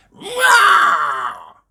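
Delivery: high, loud little monster roar; George roaring. A narrator's reading. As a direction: roar like a small child playing monster. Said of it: "said George".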